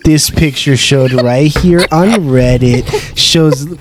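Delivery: slow, dragged-out countdown narrator impression; Chills. Performing the YouTuber's bit for co-hosts, slow drawn-out narrator voice. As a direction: read it slowly like a hammy YouTube list host. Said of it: "Oh, Chills".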